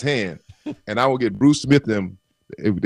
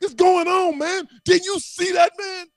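retold angry shout; slower than usual. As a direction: yell at the blocker who let him through. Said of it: "I said".

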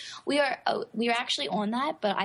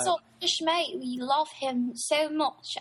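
higher-pitched amateur British accent; attempted British accent; 5 semitones higher than usual. Shown as a demonstration. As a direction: put on a playful British accent, unsure of it. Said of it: "doing a right British accent".

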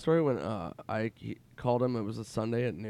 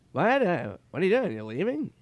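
high puzzled what, louder; surprised Norm; 8 semitones higher, 4 dB louder than usual. Retold or imitated. Norm, nine hours late, seeing his friend leave. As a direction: innocent late arrival.

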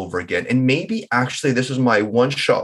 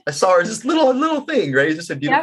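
doting; 10 semitones higher, faster than usual. Real, not acted. Doting on his tiny wife.

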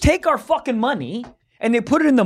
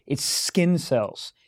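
slow, low, quieter gross-out; disgusted, slow; 5 semitones lower, 7 dB quieter, much slower than usual. Real, not acted. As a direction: drag it out in disgust. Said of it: "That's what's fucking disgusting".

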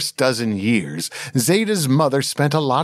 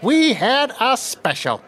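higher, boastful chef voice; proud chef; 10 semitones higher than usual. A narrator's reading. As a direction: brag about the giant fish. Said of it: "said the chef proudly".

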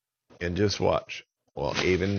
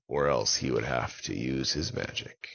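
plain reading voice

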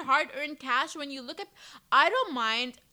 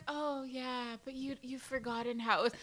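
softer, mock-condescending reply; mimicked dismissal; 9 dB quieter than usual. Younger mom brushing off older mother, softly mocking. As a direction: imitate a doubtful young mom, quietly.

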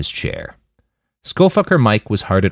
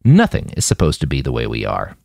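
plain reading voice

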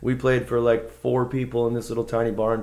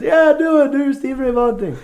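much higher, louder egging-on chant; cheering crowd; 10 dB louder than usual. Retold or imitated. Everybody urging him to play [name], high excited. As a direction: imitate a room egging him on.

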